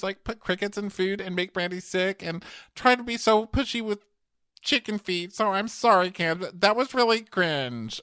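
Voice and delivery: high mock-earnest confession; a whiny put-on voice that slows at the end; imitating Stephanie. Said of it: "and she goes".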